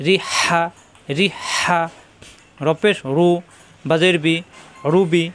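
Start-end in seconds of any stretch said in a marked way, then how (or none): 0.1-1.8 রি হা with a tight breathy h on হা; pressed throat h. Shown as a demonstration. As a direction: push হা from the throat. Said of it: "কণ্ঠ নিচে চাপ দিয়ে". (রি হা, হা-তে কণ্ঠে চাপ দিয়ে)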